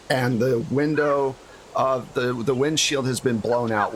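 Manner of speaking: usual speaking voice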